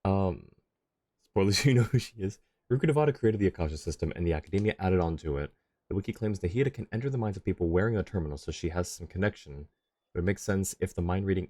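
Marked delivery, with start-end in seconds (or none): none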